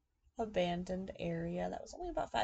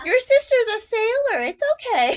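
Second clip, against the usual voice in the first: sassy aunt; 12 semitones higher, 14 dB louder than usual. Retold or imitated. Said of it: "she goes".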